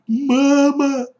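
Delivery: loud, high wail for mother; high panicked squeal; squealing scream. Acted. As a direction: scream for help like a terrified child. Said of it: "заверещал Коля".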